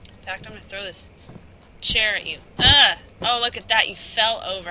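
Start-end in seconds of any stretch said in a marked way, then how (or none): 2.6-2.9 effort grunt. Real, not acted. Throwing the chair.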